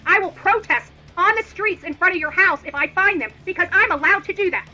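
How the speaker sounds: normal talking voice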